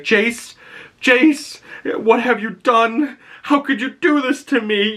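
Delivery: high, wailing mock lament; imitating a grieving mother. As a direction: wail his name like a broken mother. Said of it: "and she's like".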